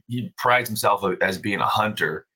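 usual speaking voice

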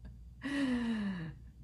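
nervous high-pitched laugh; high embarrassed laugh. Real, not acted. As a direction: laugh it off awkwardly. Awkward laugh after being vulnerable.

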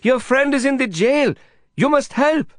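high, pleading Indian friend voice; Prabhakar's high excited plea; urgent Prabhakar. Acted.

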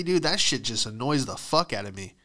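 ordinary talk, nothing marked

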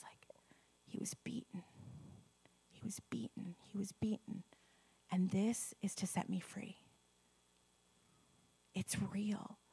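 reverent whisper; soft unvoiced awe. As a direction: hush it, as if at the cross. Whispering about crucifixion.

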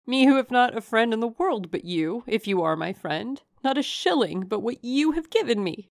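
high, emphatic protest of disbelief; incredulous heroine. A narrator's reading. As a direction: protest in amazed, lifted tones. Narrator voices the incredulous heroine.

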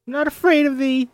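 put-on high, loud defiant little girl; frightened girl faking courage; defiant child. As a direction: stand up to her, voice high. Mercy defies witch.

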